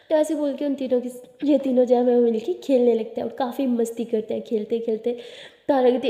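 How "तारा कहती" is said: usual speaking voice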